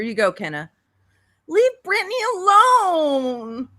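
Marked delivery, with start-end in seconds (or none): 1.5-3.7 high, slow, whining plea; whiny voice. Retold or imitated. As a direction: plead in a shrill whiny voice. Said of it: "we don't like that voice".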